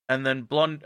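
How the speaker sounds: ordinary talk, nothing marked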